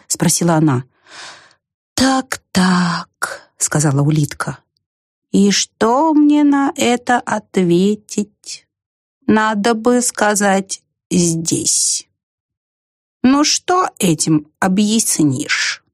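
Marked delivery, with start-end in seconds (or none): 2.0-3.5 ponderous, drawn-out snail voice; unhurried, pondering creature; slow snail. A narrator's reading. Same slow snail character. 5.3-15.8 ponderous, drawn-out snail voice; unhurried, pondering creature; slow snail. A narrator's reading. Same slow snail character.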